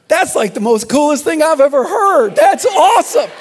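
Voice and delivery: loud, high-pitched excited exclamation; retold outburst. Pastor thrilled by his critic's complaint, loud excited outburst. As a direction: burst out with delighted amazement. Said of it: "I couldn't be quiet at that point".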